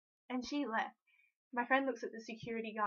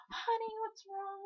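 tender concern; 11 semitones higher than usual. Retold or imitated. Jenna comforts crying fan.

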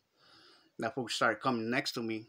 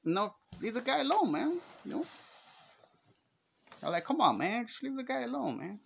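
pleading; 11 semitones higher, 4 dB quieter than usual. Retold or imitated. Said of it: "all I just said was".

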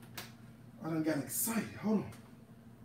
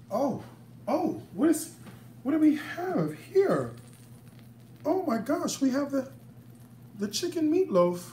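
excited; 5 semitones higher than usual. Real, not acted. Excited reveal of the dish.

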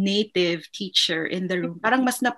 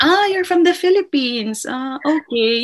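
higher, louder, flat-interest principal; a polite but bored principal, pitched up; quoting the principal; 6 semitones higher, 6 dB louder than usual. Retold or imitated. Principal greeting the Filipino teacher, higher and offhand. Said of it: "when I was there on my first day".

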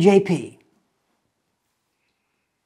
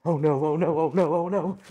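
ordinary talk, nothing marked

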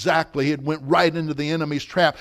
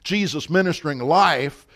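normal talking voice

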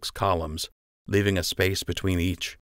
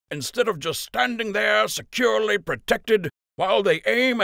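Captain Fry's rousing speech; 12 semitones higher than usual. A narrator's reading. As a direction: rouse the soldiers for battle. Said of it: "Captain Fry called".

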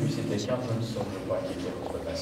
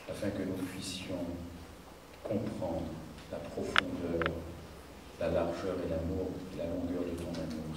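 soft, low prayer voice; 3 semitones lower, 6 dB quieter than usual. Real, not acted. Pastor asking God to open the word for the congregation, praying softly. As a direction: pray quietly, with pauses between phrases.

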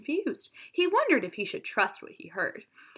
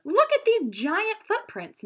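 amazed; 11 semitones higher, 4 dB louder, slower than usual. A narrator's reading. Madison marvels at footprints.

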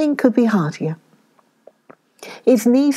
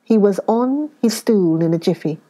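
plain reading voice

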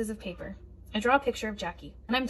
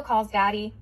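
ordinary delivery, nothing marked

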